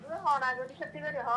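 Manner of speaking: ordinary delivery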